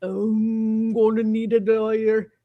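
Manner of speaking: put-on slow, lightly Indian-accented voice; a light imitation of Deepak Chopra; slow mock Indian accent; Deepak Chopra impression. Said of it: "I can't do Deepak".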